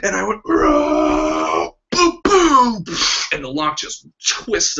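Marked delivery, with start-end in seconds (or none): 0.5-1.7 battle roar. Retold or imitated. Final swing at the lock. 1.9-2.8 loud, higher booms imitating hammer blows; mock impact booms. Man smashing his bike lock, loud booming sound effects.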